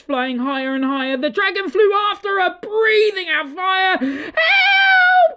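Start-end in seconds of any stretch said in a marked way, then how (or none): 4.0-5.4 very high, loud cry for help; cried help. Acted. Witch fleeing the dragon, shrieking for help. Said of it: "cried the witch".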